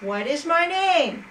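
a gleeful little trickster demanding his riddle; little man's demand. Acted. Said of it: "the little man appeared and said".

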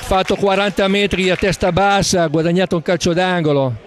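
quick live football commentary; live play-by-play. Acted. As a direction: call the action as it happens.

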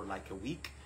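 ordinary talk, nothing marked

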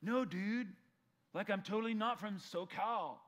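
SoCal dialect. Acted. Preacher mimics a local giving away his hometown, SoCal accent. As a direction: play up a protesting SoCal accent. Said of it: "reveal where he's from".

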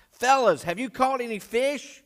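high, loud hail across water; calling out. Acted. Jesus on the beach to disciples in the boat, calling out. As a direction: holler it to people far off, pitched well up. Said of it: "he called out".

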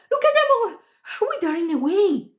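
put-on high-pitched amazed boy; excited exclamation. A narrator's reading. Second boy points out withering flowers, high excited call.

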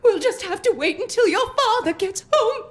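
melodramatic, quavering lament; tearful distress. Acted. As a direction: wail through anxious tears. Tearful mother awaits news of creditor.

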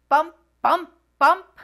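loud, high bouncing bump bump bump; bumpy bus sounds. Acted. Reader acting out the bumpy bus ride, loud bouncy bumps.

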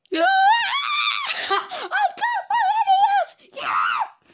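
very high laughing shriek; screaming excited kid. Retold or imitated. Imitating the crying NES kid getting his console, shrieking.

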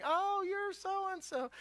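high, slow dawning recall; sudden recognition. Retold or imitated. Old priest finally placing the visitor, high and slow. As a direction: drag it out as it clicks. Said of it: "He's like".

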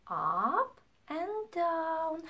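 ordinary delivery

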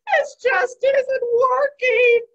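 slow, high, loud complaint; exasperated wail. Acted. Spider overwhelmed by all the noise, exasperated wail. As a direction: wail it out, at wits' end.

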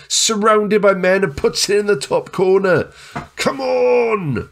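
high-pitched triumphant commentary; excited goal call. Real, not acted. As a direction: build to a drawn-out cheer.

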